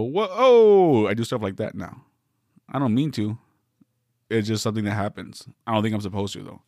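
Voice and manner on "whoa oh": sudden high, loud "Whoa! Oh!"; mock startled shout. Shown as a demonstration. Showing how he reacts now when mad, loud startled shout. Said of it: "I go".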